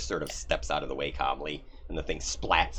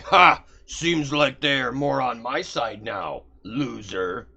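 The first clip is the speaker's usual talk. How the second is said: loud, higher, slow gloating taunt; gloating demon; 8 semitones higher, 9 dB louder, slower than usual. Acted. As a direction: gloat over the rival loudly. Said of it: "Orcus is like".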